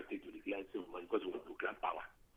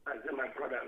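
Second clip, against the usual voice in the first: speaking up, louder and higher; to someone hard of hearing; 5 semitones higher, 3 dB louder, faster than usual. Real, not acted. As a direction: repeat it louder so they hear. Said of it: "You'd have to speak up".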